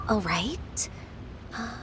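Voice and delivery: soft unvoiced whisper, thinking; whispered aside while deciding; whispered to herself. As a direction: breathe it privately while pondering.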